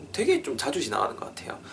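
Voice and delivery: whispered aside over the plane noise; unvoiced, hushed remark. Real, not acted. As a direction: murmur it under the airplane noise. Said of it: "비행기 큰 거 지나간다".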